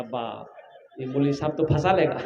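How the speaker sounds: whisper-like fearful aside with a laugh; mock worried mutter. Seated young man frets the preacher will trap him, soft laughing aside. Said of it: "बोला".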